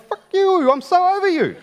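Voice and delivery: high, loud furious yell; shrill hammed-up yell; mock yell. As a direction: scream it back at her. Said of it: "And I was like".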